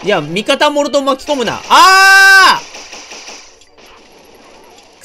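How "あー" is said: long high frustrated yell; frustrated scream. Real, not acted. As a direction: scream out as the attack lands. Hit by an attack in a boss fight.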